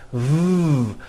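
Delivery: V as one long sustained buzz; voiced V sound. Shown as a demonstration. Teacher demonstrating the V sound, long voiced V buzz. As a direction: hold a buzzing V, no vowel. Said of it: "It's always".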